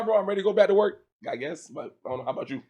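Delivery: ordinary talk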